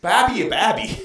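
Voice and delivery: put-on high, loud Boston accent. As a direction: question it in a thick mock Boston accent. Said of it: "How about Boston?".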